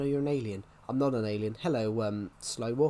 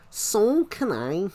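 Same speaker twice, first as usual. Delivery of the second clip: slow, drawn-out Scouse, pitched up at first; Scouse impression showing off for the stream; Scouse accent; 3 semitones higher than usual. Shown as a demonstration. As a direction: put on a broad Liverpool Scouse accent. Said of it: "I can do Scouse".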